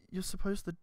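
ordinary talk, nothing marked

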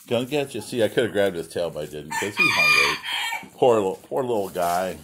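ordinary talk, nothing marked

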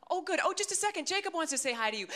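high, bubbly phone voice; chirpy mother friend. Retold or imitated. Friend on the phone putting her son on, mock chirpy. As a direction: imitate an eager mum, pitched well up.

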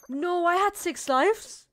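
loud, high, slow cry of disbelief; shocked disbelief. Real, not acted. Shocked at losing a life.

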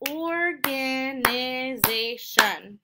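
"organization" in five slow, stretched beats; syllable by syllable. Shown as a demonstration. Teacher counting five syllables, "organization" slowly split up. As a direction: split "organization" as or, ga, ni, zay, shun. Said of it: "has five syllables".

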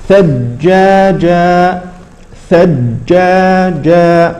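ثجاجا slow, the doubled ج unbounced; slow recitation. Shown as a demonstration. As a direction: stretch ثجاجا smoothly. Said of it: "لا تقلقل". (ثجاجا بتلاوة بطيئة)

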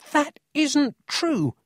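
high, slow, near-tearful angry protest; a boy almost crying with indignation; shouted angrily. A narrator's reading. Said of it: "Emil shouted angrily".

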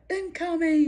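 high warning call at normal volume; retold warning call. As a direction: call it out high without shouting. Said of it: "so I'd be like".

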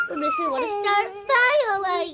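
hammed-up crybaby complaint; mock crying whine. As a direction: whine it like a fake tantrum. Mock whining during gameplay.

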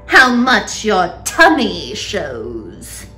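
loud, slow jeer; mocking aunt, drawn out; crying out. A narrator's reading. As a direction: drag out the taunt with relish. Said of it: "Aunt Spiker cried".

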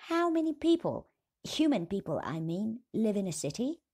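high, loud anxious little voice; small dwarf voice. A narrator's reading. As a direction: ask it high and nervous. Smallest dwarf asks, worried.

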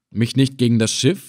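ordinary delivery, nothing marked

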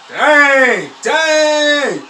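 high, loud laughing dang; laughing shout. Real, not acted. Streamer watching the referee grab a player, laughing shout. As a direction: shout it through real laughter.

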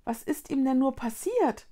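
plain reading voice

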